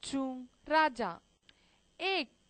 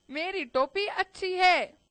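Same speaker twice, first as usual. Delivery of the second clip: higher, raised-voice royal boast; insistent king; 5 semitones higher, 4 dB louder than usual. A narrator's reading. King argues with mouse over caps, insistent higher boast. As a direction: push the claim up and out. King claims his cap is better.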